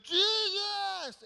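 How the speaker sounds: high, loud cry of a blind beggar; desperate scream. Acted. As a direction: scream his name across the crowd. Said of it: "you hear Bartimaeus".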